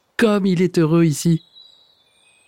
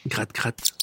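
much softer, lower scratching sound; hushed, as if remembered from far away; soft memory; 4 semitones lower, 10 dB quieter than usual. A narrator's reading. Remembering friends' sounds, eyes closed.

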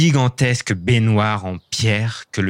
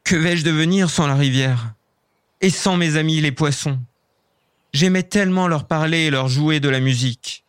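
moaning elf; 7 semitones higher than usual. Acted. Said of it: "Flint gémit".

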